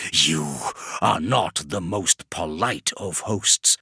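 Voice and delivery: slow, odd-toned reproach; chiding demon. Acted. Vashtor chides Kravarek, slow and sly. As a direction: chide the poor host.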